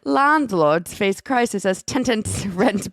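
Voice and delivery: loud, slow British headline read; amateur British accent, laughing partway; attempted British accent. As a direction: overdo the British T's and vowels. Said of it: "give me the headline".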